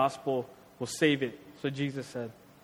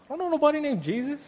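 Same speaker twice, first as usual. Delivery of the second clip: much higher, fast, squeaky mock panic; cowardly denial; 10 semitones higher, faster than usual. Peter denying Jesus, high fast panicked denial. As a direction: blurt it out like a scared coward. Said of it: "turned into a coward".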